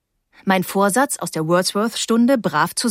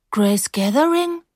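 slow, higher, questioning repeat; puzzled question; 5 semitones higher than usual. A narrator's reading. Narrator not recognising her old friend, puzzled. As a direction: repeat the name, not placing it.

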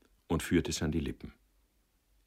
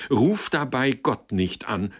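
loud, high, slow rebuke; fierce raised-voice outburst; wild cry; 7 semitones higher, 8 dB louder, slower than usual. A narrator's reading. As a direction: cry out to stop him. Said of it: "rief sie wild".

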